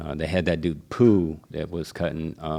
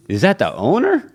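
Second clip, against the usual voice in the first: much higher, louder disbelief; shocked question; 11 semitones higher, 7 dB louder than usual. Retold or imitated. Barber hearing the owner threatened him, shocked and higher. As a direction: ask it stunned.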